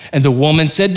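ordinary talk

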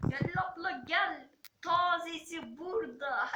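loud sing-song market call; melodic street vendor shout. Pretend. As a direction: hawk fresh produce loudly. Imitating a bazaar vendor call.

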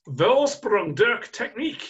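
put-on slow, drawn-out German accent; a hammy attempt at a German accent. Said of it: "my best German impression".

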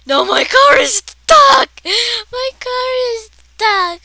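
high loud half-crying wail; wailing shout. Real, not acted. Kid wails at the game car, crying. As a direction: wail over the stuck car.

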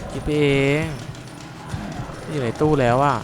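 anxious playful taunting; nervous taunt. Real, not acted. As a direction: tell the monster it imagined it. Taunting the monster from cupboard.

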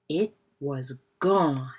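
usual speaking voice

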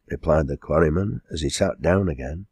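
ordinary delivery, nothing marked

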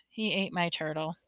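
soft, voiced murmur; subdued. A narrator's reading. Boy telling the ambulance attendant, subdued.

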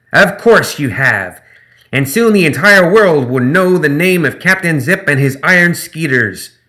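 high, loud, slow gremlin swagger; gloating gremlin. Acted. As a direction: cover surprise with bravado. Gremlin boast.

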